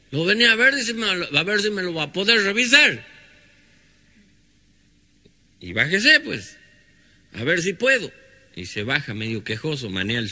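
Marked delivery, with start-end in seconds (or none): 0.1-8.1 much higher, louder rustic exchange; country folk voices. Acted. Sick visitor and healer talking at the gate, high and loud.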